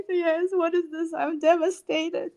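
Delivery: high, tearful mock crying. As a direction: whimper it like you're about to cry. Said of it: "my crying voice".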